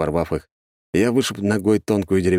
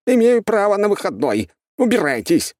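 very high-pitched, indignant cry; yelled refusal; 11 semitones higher, 3 dB louder than usual. A narrator's reading. Doctor yells at visitors from inside the flat, high wail. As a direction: shriek at them to go away. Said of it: "раздался вопль из квартиры".

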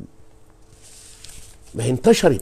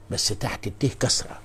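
ordinary delivery

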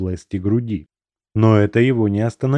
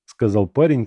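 ordinary delivery

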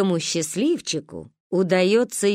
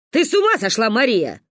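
louder, higher indignant scold; angry; 3 semitones higher, 5 dB louder than usual. Acted. Grandma angrily scolds Mariya, loud and high. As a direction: scold the cheeky girl. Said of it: "разозлилась Ба".

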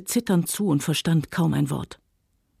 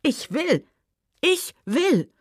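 insistent cry; 11 semitones higher, slower than usual. Acted. Scarlett insisting, near hysteria.

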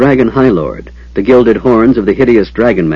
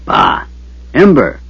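calling out; 2 semitones higher, slower than usual. A narrator's reading. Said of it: "Verminard called".

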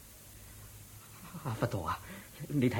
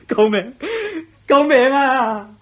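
much louder, higher, drawn-out scream for help; panicked cry for help; 13 semitones higher, 8 dB louder, much slower than usual. Acted. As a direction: scream in terror. Fears turning into a donkey. (驚到大叫救命)

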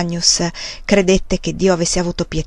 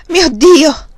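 high, loud cry of dismay; a pale monk's stunned outburst; startled cry; 8 semitones higher, 6 dB louder than usual. A narrator's reading. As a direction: cry out, shaken and caught off guard. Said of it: "gridò sconcertato".

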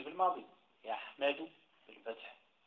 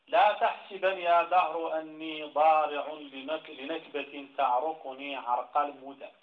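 slow, louder poetry recitation; verse recitation; 2 semitones higher, 6 dB louder, slower than usual. Shown as a demonstration. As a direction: declaim the Arabic verse deliberately. Recites next verse of the poem.

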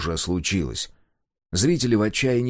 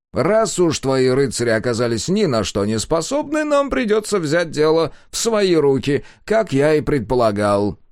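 smug villain; 6 semitones higher, 4 dB louder than usual. A narrator's reading. Villain speaks.

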